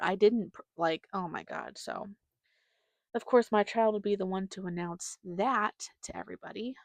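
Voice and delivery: normal talking voice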